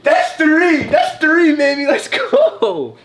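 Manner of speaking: shouting in triumph; big hyped-up celebration; excited cheer. Real, not acted. Celebrating a third win.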